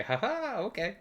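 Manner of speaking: very high mock giggle; hammed-up falsetto laugh; cop laughing it off. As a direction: chirp it like a fake-friendly cop. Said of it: "And I say".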